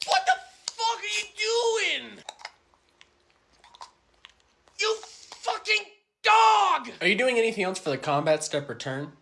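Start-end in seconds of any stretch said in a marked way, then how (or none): 0.1-6.9 high, slow, drawn-out furious yell. Acted. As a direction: drag each word out in outrage. Outraged player at game table.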